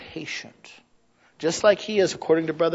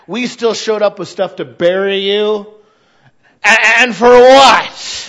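normal talking voice

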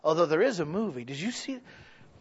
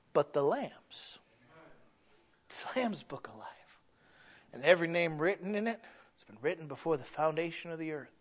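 soft, lowered preaching voice; reverent hush; 2 semitones lower, 8 dB quieter than usual. Real, not acted. Preacher revealing whose book it is, hushed. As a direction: drop suddenly into a hushed tone. Sudden hush after the shouting.

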